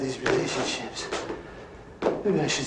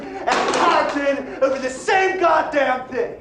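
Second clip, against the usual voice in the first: laughing rant; 4 dB louder than usual. Acted. Peter raging at his nagging wife, high laughing shout.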